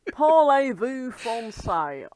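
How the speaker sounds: put-on slow, loud French accent, laughing; mock French accent with a laugh; dodgy French accent. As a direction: drawl it as a fake Frenchman. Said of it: "dodgy accents".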